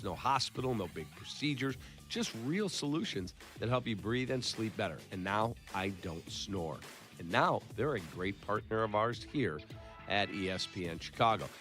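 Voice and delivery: ordinary talk, nothing marked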